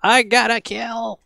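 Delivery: high, loud, slow triumphant shout; excited. Real, not acted. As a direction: cheer the first kill. First kill in game.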